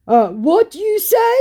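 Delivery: loud, high imitation of a Nigerian accent; Nigerian-accented yell. Scammer yelling from across the room, mock Nigerian accent. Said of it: "yelled from across the room in a Nigerian accent".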